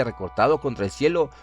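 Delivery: usual speaking voice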